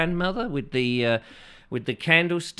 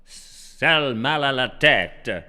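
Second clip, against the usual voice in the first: mock French phrase; 5 dB louder, much slower than usual. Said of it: "the sexy French accent".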